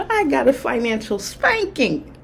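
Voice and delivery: laughing. Real, not acted. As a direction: say it through real amused laughter. Repeats her joke while laughing.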